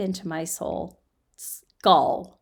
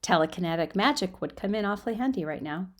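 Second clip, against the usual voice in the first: plain reading voice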